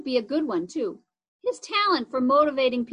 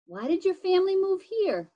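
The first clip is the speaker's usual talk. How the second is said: asking over noise; 7 semitones higher than usual. Acted.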